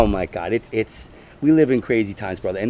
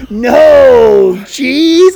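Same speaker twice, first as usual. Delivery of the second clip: high, loud disgusted "no cheese?"; 7 semitones higher, 10 dB louder than usual. Retold or imitated. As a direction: recoil in disgust at the idea. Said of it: "she was disgusted".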